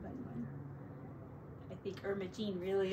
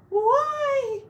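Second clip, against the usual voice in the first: surprised why; 14 semitones higher, 9 dB louder than usual. A narrator's reading. Character voice in story.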